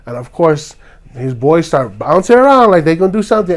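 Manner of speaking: usual speaking voice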